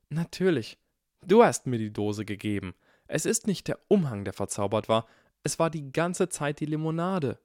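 the penny drops, voiced with a lift; excited realization. A narrator's reading. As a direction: Hermine cracking the puzzle, a bright rising exclaim. Hermine realizes the trick excitedly.

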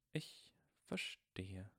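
slow, breathy whisper, unsure; hesitant whisper. A narrator's reading. McGonagall taken aback by Harry, hesitant whisper. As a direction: barely breathe the words, hesitating. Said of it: "sagte McGonagall zögerlich".

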